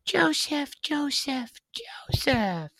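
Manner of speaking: high, fading dying call; dying whisper. Retold or imitated. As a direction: call out weakly, then whisper. Brigham Young's dying last words.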